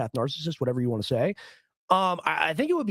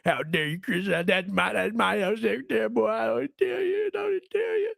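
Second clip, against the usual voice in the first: squeaky, much higher mock bro voice; mocking tough-guy fan; 5 semitones higher than usual. Mocking a MAGA fan who is offended for Chris, squeaky and far higher. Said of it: "they go".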